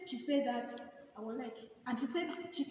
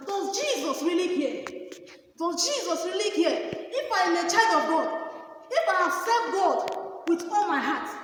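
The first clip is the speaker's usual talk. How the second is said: rising, louder preaching voice; 3 semitones higher, 6 dB louder than usual. Acted. Testifying to the congregation, asking if Jesus cares, preaching.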